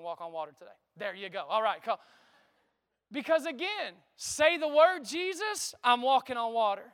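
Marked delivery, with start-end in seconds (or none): 4.2-6.9 preaching build. Acted. Preacher voicing Peter's bold plea to Jesus. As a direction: lift it like a sermon peak. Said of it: "preaching at full force".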